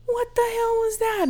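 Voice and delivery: high startled demand. Retold or imitated. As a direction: call out high in alarm. Opening door after window hit.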